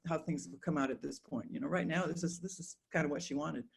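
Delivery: ordinary talk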